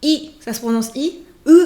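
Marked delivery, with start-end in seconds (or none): none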